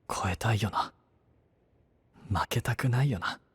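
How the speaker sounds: hushed, half-whispered regret to himself; boy whispering sorry to an absent friend; quiet inner monologue. Acted. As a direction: murmur the apology under the breath, as if she can't hear.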